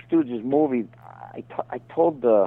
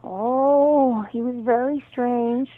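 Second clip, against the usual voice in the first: high-pitched, drawn-out gossipy confiding; June Foray impression; 11 semitones higher, slower than usual. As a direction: put on a high voice, slow on the key words. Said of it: "I talked to June Foray once".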